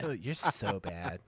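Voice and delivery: slow, lisping mock "so bad"; lisping tease. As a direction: drag it out with a lisp, laughing. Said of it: "You gotta put more lisp in it".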